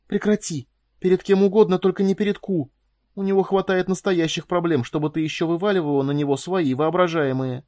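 high, urgent, agitated reproach; loud high outburst, easing toward the end; distraught self-scolding. A narrator's reading. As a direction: scold yourself sharply through rising panic. Cordelia scolds herself while breaking down.

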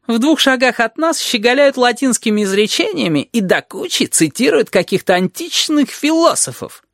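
high-pitched sarcastic griping; aggrieved lizard. A narrator's reading. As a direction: complain hotly, capped with a bitter tag question. (ящер, возмущённо жалуется)

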